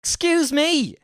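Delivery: very high, loud indignation; outraged. Real, not acted. Reactor interrupting the accent lesson, outraged. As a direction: burst out in offended disbelief.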